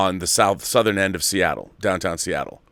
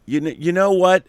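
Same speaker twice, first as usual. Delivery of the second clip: ordinary delivery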